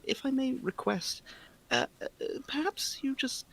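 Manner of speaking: prim polite request. Acted.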